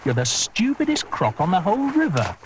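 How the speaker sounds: scornful creature taunting; high jeering taunt; mocking voice. A rival croc jeering at the crocodile, high and scornful. Character voice in story.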